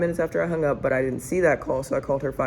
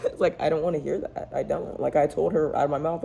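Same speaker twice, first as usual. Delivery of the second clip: ordinary delivery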